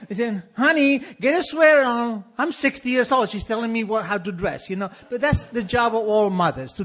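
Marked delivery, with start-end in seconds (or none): 0.6-2.2 high, loud nagging mom voice; shrill-free but high, pushy mother call; mock mother nagging; mother's nag. Imitating mother nagging him.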